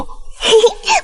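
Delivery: put-on short, high childlike giggle; little high laugh; pleased giggle. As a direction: giggle happily at being thanked. Child pleased at being thanked.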